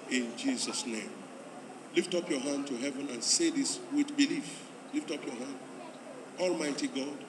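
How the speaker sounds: soft, measured prayer lines; leading a prayer. Real, not acted. Pastor leading new converts in prayer, earnest and soft. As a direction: lead the prayer phrase by phrase, earnest.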